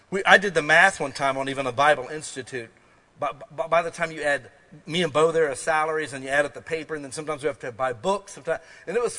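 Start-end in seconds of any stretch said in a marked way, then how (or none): none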